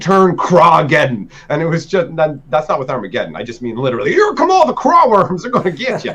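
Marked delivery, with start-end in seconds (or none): 4.1-6.1 high, excited gloating; gleeful taunt. Pretend. A kid gloating as his Crawworms attack, high glee. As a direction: crow over the attacking swarm. Voicing Crawworms swarming the opponent.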